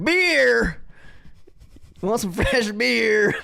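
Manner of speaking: loud, high concert hawker's shout; imitating a beer seller working the crowd; beer vendor call. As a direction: holler for customers with stretched-out words. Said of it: "people walking around like".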